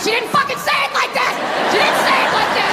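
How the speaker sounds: high-pitched exasperated protest; hammed-up outraged complaint; indignant defendant. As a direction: yell your objection to the misreading. Accused man protests in court.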